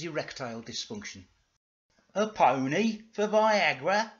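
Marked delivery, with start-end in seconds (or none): 2.1-4.1 incredulous, higher and louder character voice; slow, indignant question; Dougal. Acted. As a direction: draw it out in high, loud disbelief. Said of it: "said Dougal".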